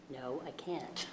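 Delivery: almost inaudible with a little laugh; barely audible. Real, not acted. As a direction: murmur it very softly. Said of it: "This is as good as my voice gets".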